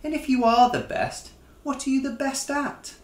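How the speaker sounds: high, quick, teasing wife; Ma Lion's voice. A narrator's reading. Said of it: "Do stop boasting".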